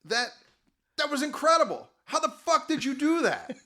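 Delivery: high, loud, astonished outburst; amazed shout. Acted. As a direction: yell it in disbelief. Hobo amazed after climax.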